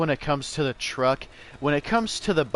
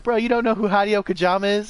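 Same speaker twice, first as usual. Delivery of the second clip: incredulous friend; 8 semitones higher, 5 dB louder, faster than usual. Retold or imitated. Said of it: "Be like".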